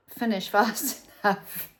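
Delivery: chuckling it out; laughing. Real, not acted. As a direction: laugh through the words. Laughing about the year flying by.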